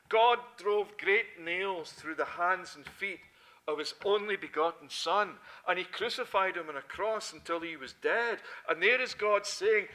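loud, rising sermon delivery; forceful preaching. Acted. Preacher driving home the cross to the congregation, loud and forceful.

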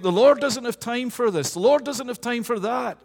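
"The Lord" thrown out high and loud, brushing it off; dismissive inner voice. Retold or imitated. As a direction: sound like someone too busy to bother, voicing the wrong thought. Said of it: "and we think".

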